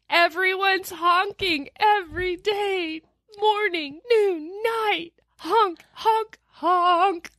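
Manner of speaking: high, hammed-up whine about the honking; mock honking complaint. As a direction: squeal out the honks like a cartoon car horn. Mocking the constant honking.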